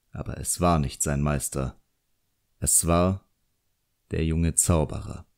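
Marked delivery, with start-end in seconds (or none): none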